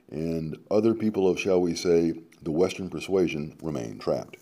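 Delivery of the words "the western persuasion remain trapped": usual speaking voice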